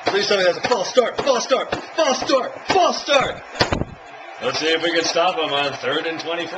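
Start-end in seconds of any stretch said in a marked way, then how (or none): 0.0-3.4 rising louder and higher with each repeat; begging the referee; urgent, hopeful repeated plea; pleading fan. Real, not acted.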